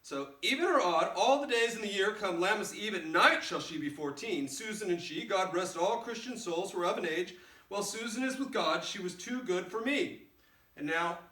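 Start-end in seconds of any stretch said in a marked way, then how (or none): 0.4-10.2 loud, higher nurse reading, chattering on. Acted. Teacher reading the nurse's speech aloud, louder and higher. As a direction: read out the rambling nurse with gusto. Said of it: "I'm gonna read this speech".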